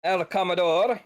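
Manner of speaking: high mock Italian accent, loud; El Commodore with Italian flair. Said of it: "an Italian accent".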